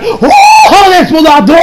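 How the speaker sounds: high, loud mock-dramatic shriek; shrill theatrical outburst; outraged villain cry. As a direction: cry out like a scandalized cartoon villain. Villain cries out in acted quote.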